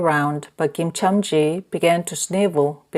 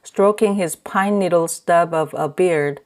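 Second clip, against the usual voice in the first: ordinary delivery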